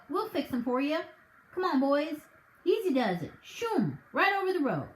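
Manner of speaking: folksy officer smoothing things over; a touch of a country sergeant calming a quarrel; lightly voiced sergeant. Sergeant's character voice.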